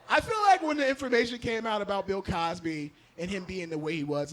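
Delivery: usual speaking voice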